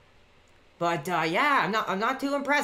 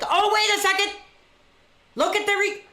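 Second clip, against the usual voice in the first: much higher and louder alarm; surprised; 11 semitones higher, 4 dB louder than usual. Real, not acted. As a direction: jump up in sudden alarm. Alarmed at replay.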